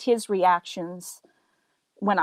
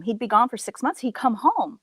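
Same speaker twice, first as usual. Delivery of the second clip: ordinary talk, nothing marked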